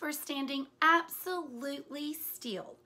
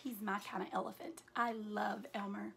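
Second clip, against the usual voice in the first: soft, quick personal aside; quiet aside; 3 semitones lower, 9 dB quieter, faster than usual. Real, not acted. Reader telling listeners she loves Elmer, softly and quickly. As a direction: slip in a fond little aside. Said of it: "reader's own aside".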